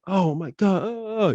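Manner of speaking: high-pitched imitated gasping wail; horrified onlookers. Onlookers turning to the accident, imitated horror.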